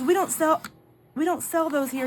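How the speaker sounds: high-pitched imitation of earnest clerk; so serious. Young clerk explaining they don't stock edibles, so serious. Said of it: "and he was so serious".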